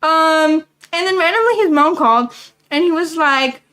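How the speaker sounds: usual speaking voice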